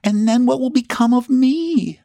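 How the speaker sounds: high, acted tearful wail; sobbing plea. A narrator's reading. Miss Cecropia begging her friend to stay, acted sobbing. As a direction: wail it high, as if close to tears. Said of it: "she sobbed".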